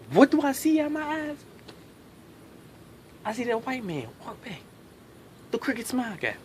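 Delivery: ordinary talk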